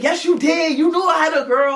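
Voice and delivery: imitating the boyfriend's heated reply; retold argument. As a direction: argue back at the girl. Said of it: "He like".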